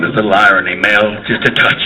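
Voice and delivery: low, wry divine voice; voice of God. Acted. God needling Mel about the irony, low and wry. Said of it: "God's going".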